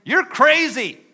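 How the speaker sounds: high, loud, outraged disbelief; indignant crowd shout. Acted. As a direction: yell it back as the angry mob. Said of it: "The crowd is saying".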